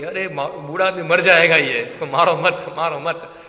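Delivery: loud mock pleading; imitated distressed wife. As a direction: play a wife pleading loudly to stop a beating. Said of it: "तो उनकी पत्नी दुखी हो गई". (दुखी पत्नी की नकल में ज़ोर से गुहार)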